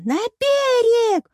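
very high, loud, drawn-out call; triumphant cry. A narrator's reading. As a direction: proclaim it while rushing off. Penelope triumphantly rushing to the door. (торжествующий протяжный крик)

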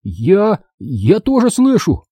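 high, drawn-out excited cry; exclaiming. A narrator's reading. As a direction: exclaim in hope, voice lifting. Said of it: "воскликнул я". (взволнованное восклицание)

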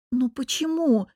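indignant, blurted out; indignant outburst. A narrator's reading. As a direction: blurt it out in protest. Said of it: "возмущенно выпалила я".

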